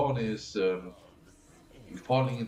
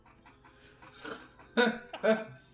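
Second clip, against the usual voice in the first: breathy laugh; 12 semitones higher than usual. Real, not acted. Streamer laughs.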